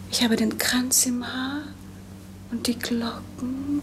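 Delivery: normal talking voice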